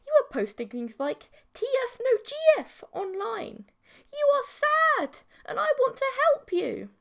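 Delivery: very high, loud sugary voice; eerie sweet creature. A narrator's reading. Mantis girl exposes his lonely posts, high and sugary. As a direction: press him with creepy sweetness.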